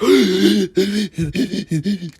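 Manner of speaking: a sharp, high, breathless gasp, as if the wind was knocked out; imitated gasp for air. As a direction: gasp hard after being slammed to the ground. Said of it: "trying to get my air back".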